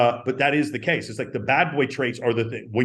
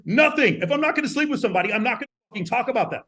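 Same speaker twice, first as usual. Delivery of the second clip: much higher and fast; fired-up rant; 9 semitones higher, faster than usual. Real, not acted. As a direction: rattle it off, worked up. Emphatic aside.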